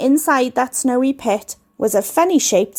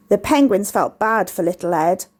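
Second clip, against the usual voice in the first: ordinary delivery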